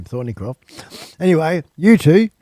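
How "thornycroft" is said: slow and much softer, savoring Thornycroft; savoring the name. Shown as a demonstration. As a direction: draw out Thornycroft softly, relishing it. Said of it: "I reckon that's a cool name".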